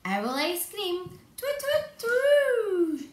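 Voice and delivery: slow, high, loud hooting; frightened owl hoot. Acted. Owl hears it's ice cream, hooting loud and high.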